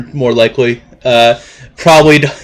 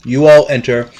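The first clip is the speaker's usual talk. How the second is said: game master narration. Acted. Game master's scene setting.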